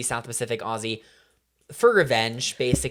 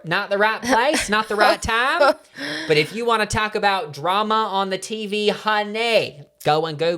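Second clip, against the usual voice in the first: higher, louder hammed-up Southern belle; mock Southern accent; 7 semitones higher, 5 dB louder than usual. Host shooing listeners to Google gossip in Southern accent. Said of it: "My accent is getting so".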